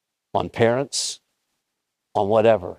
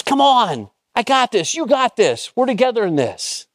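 high-pitched, excited rallying cry; cheering encouragement; 11 semitones higher than usual. Acted. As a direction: cheer them on as their biggest supporter. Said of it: "he's cheering for you".

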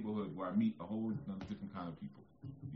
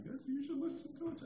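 very high mock prissy voice; mocking listeners. Mocking critics who disagree, high falsetto mock voice. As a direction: parody the disapproving critics. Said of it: "some of y'all like".

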